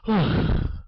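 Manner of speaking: an unvoiced sigh of relief; relieved breathy sigh. Abraham's relief at the angel.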